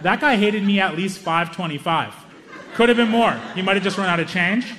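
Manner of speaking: normal talking voice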